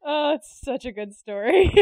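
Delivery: ordinary talk, nothing marked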